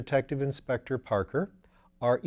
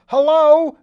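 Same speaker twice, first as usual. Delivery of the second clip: a huge, much higher, much louder yell into the phone; yelled hello; 18 dB louder than usual. A narrator's reading. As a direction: yell it hard enough to crack the receiver. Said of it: "yelled into it a".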